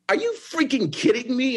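retold incredulous protest, pitched high; indignant outburst. Job seeker confronting the boss in his office, indignant. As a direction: act out outraged disbelief, high-pitched.

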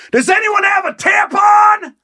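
high, drawn-out shriek imitating a woman; screaming woman. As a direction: yell it out slowly across a whole lobby, mimicking her. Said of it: "just screams into the lobby".

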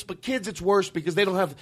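ordinary delivery

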